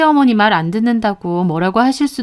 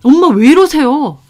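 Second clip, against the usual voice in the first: raised, high protesting cry; alarmed protest; 6 semitones higher, 7 dB louder than usual. A narrator's reading. Husband holding back his mother, loud alarmed protest. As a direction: protest loudly, pitched up.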